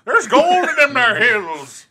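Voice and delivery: put-on slow, loud old-timer drawl; hammed-up gold-rush prospector. Prospector voice after forty-niners talk.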